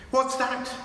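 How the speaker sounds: high, startled question; a scared girl in the dark; frightened gasp. A narrator's reading. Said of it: "said Lucy, clutching Susan's arm".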